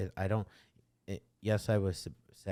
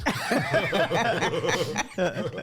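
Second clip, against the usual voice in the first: loud, high, real belly laugh; burst of laughter; 11 semitones higher, 10 dB louder than usual. Real, not acted. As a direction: crack up loudly at the joke. Joking about the stolen car.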